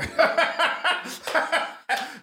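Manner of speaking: high, loud burst of laughing; laughter. Real, not acted. Co-host teasing friend he would avoid, laughing loudly. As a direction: crack up out loud.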